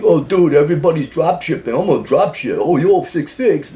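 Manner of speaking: fake dude bro. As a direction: parody a hyped-up drop shipper. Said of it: "You'll be the fake dude bro".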